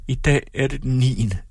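usual speaking voice

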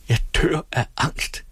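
cling on and confess terror; fear. Acted. Else clinging to the nurse, voicing terror. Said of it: "sagde hun".

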